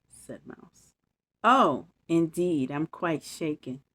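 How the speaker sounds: put-on lively bedtime story read to kids; story narration. A narrator's reading. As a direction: read it like a storybook for little ones. Reads bedtime story aloud.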